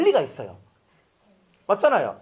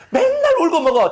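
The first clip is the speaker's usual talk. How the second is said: exclaiming; 10 semitones higher, 5 dB louder than usual. Real, not acted. Preacher exclaims loudly.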